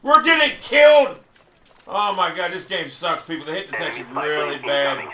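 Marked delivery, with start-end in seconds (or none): 0.0-1.2 loud, high cry of disbelief; outraged shout. Real, not acted. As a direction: yell in outrage at getting killed. Outraged at being killed in game.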